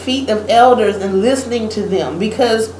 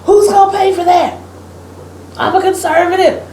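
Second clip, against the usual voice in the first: indignant conservative lady; 9 semitones higher, faster than usual. Retold or imitated. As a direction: mimic an offended taxpayer demanding who pays. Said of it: "the lady was like".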